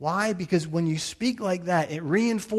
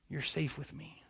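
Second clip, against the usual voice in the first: tender reassurance; 2 semitones lower, 13 dB quieter than usual. Retold or imitated. Father reassuring his child.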